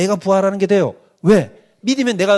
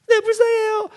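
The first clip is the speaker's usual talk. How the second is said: very high put-on childish voice; mock kids' reply. Preacher voicing kids answering the pastor in a high childish voice. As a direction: answer like an obedient child, hammed up.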